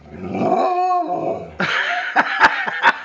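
high, loud burst of giggling; delighted laugh. Real, not acted. Owner laughing at her excited dog, high and loud.

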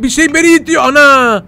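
high, alarmed yelp as the fan pushes him; panicked cry of surprise; high startled yelp. Real, not acted. Wind fan pushing him back.

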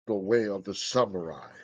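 low, soft movie-title reveal; dramatic trailer voice. Pretend.

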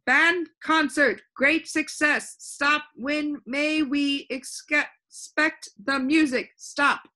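loud, high, slow telegram readout; reading a telegram. A narrator's reading. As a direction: punch out each word slowly like a telegram. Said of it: "took a telegram which stated".

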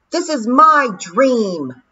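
shouted. Acted. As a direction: yell defiantly at the sky. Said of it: "she shouted at the sky".

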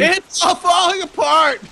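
high, loud, slow panicked yell; panicked shout. Real, not acted. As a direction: cry out as everything collapses. Game camp collapsing in rain.

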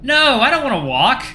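high, loud mock pleading; sarcastic plea. A narrator's reading. Passenger threatened with walking, sarcastic high plea. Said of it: "I sarcastically plead".

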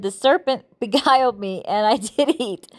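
Bible verse cracked by a giggle; real amusement mid-verse; laughing while reading. Real, not acted. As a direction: try to keep reading through the laughter. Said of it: "I'm sorry I'm laughing".

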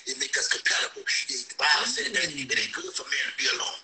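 sermon-like advice, rising in pitch at the peaks; preaching. Acted. Friend preaching to the heartbroken streamer, sermon style. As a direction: preach it to him like a pastor.

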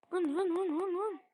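playful engine hum "vın vın"; a kid's mock motor sound; engine noises. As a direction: buzz like a revving car. Imitating car engine while driving.

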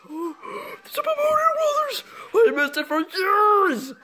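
high, drawn-out cry of joy; overjoyed wail. Acted. Fan reunited with a show missed for years, overjoyed wail. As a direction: wail it slowly as if overcome with delight.

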